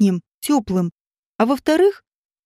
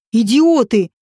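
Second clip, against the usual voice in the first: louder, contained outburst; angry yell; 2 semitones lower, 5 dB louder, slower than usual. A narrator's reading. Rodion, still tied up, yells angrily. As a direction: lash out at the fools. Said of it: "заорал Родион".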